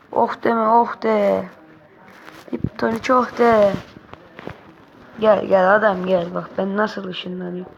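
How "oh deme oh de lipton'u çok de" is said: normal talking voice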